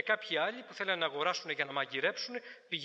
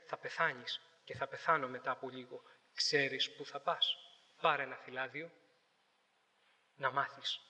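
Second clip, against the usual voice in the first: earnest warning; 6 semitones lower, 3 dB quieter than usual. Retold or imitated. Church youths warning passers-by.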